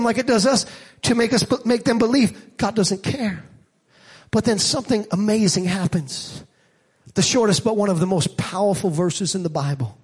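ordinary talk

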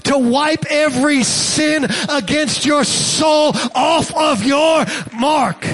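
rising sermon intensity ending in a shout; preaching at full force. Acted. Preacher assuring the congregation of forgiveness, building preaching fervor. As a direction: drive it home from the pulpit.

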